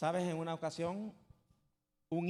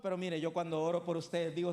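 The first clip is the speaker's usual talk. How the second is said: ordinary talk, nothing marked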